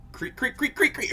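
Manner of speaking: high-pitched, quickening creaks; rapid creaking noises. Acted. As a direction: imitate something scurrying across a roof. Imitates running creaks on roof.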